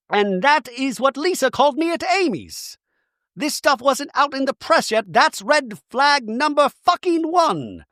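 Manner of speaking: high, emphatic rant; mock outrage. Bronwyn laying out her red flag, outraged. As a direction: imitate someone outraged, stretching the final words. Acting Bronwyn's heated accusation.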